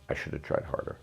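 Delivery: a soft, toneless repeat of "I should have tried harder"; quiet repeat. Real, not acted. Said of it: "Okay, as a man".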